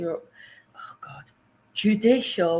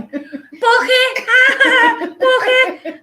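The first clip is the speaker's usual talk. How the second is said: mock American echo; 10 dB louder than usual. Mimicking American mishearing.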